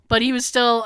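ordinary talk, nothing marked

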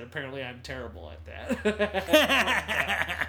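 slow, laughing through the actor's line; laughing quote. Retold or imitated. Retelling the actor's commentary joke, slow and laughing. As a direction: let the laugh spill over the quote.

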